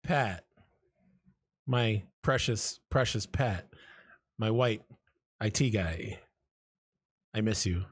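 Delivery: low, slow, heartfelt message with long pauses; tender confession, dropped low and drawn out; sincere. Pretend. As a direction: lay on the sincerity, low and unhurried. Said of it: "That sounded very sincere".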